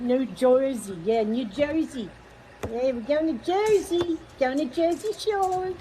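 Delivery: badly faked New Jersey accent; mock New Jersey locals off to the shore. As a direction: have a playful stab at a New Jersey accent. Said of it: "I can't do my accents".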